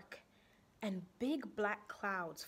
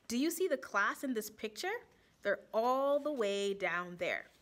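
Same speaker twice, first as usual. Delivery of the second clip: ordinary delivery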